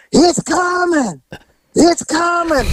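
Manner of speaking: high, slow, drawn-out wail; booming voice. Retold or imitated. Woman in labour calling from upstairs, slow and high. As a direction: stretch each word out from upstairs. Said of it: "start booming in a booming voice".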